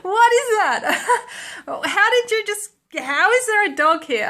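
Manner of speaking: high, loud, laughing astonishment; laughing disbelief. Retold or imitated. Roommate Lorena seeing a strange dog on the couch, laughing high and loud. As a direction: gasp it out through laughter. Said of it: "she's like".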